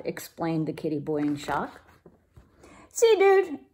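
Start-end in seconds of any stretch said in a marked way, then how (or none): 2.9-3.6 very high, eager dude; high excited Diego. A narrator's reading. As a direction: insist eagerly. Don Diego voiced.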